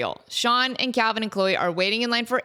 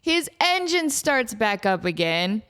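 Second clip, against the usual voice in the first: ordinary talk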